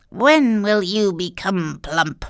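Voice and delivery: put-on slow, gloating old witch. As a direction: savour every word like a greedy witch. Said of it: "she complained".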